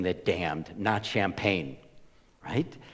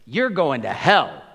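louder, sharp judgmental blurt; mock accusation; 3 semitones higher, 9 dB louder than usual. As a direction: fling the verdict at him. Server condemning the pastor.

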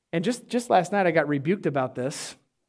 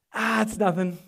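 mock brush-off in a retold reply; acted-out "I'm fine" shrug; dismissive; 3 dB quieter than usual. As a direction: wave the worry away, playing it down. Dad brushes off daughters' worry.

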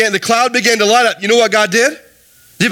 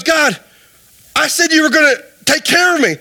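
higher-pitched mock protest; indignant complaint; 6 semitones higher than usual. Drowned man reaching heaven and challenging God, indignant. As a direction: complain to God, voice lifted high.

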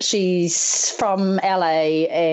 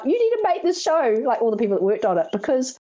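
higher, eager crew begging; excited pleading; 10 semitones higher than usual. Retold or imitated. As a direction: plead to come back.

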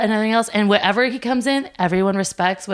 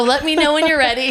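breezy one-liner; 6 semitones higher, 4 dB louder than usual. Shown as a demonstration. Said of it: "that's my one-liner".

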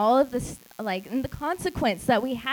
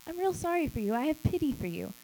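mock pity; 5 semitones higher, 4 dB quieter, faster than usual. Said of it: "look at somebody who is in need and just say".

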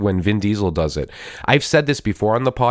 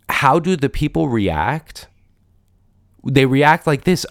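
normal talking voice

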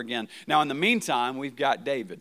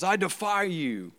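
Goliath's taunt; 5 semitones higher, slower than usual. Retold or imitated. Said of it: "here comes Goliath and he says".

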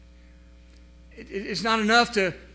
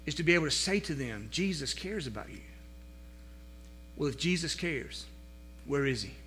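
soft preaching; 3 semitones lower, 6 dB quieter than usual. Acted. Pastor speaking gently of Jesus' care, soft preaching. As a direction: draw the congregation in quietly. Quiet intimate sermon stretch.

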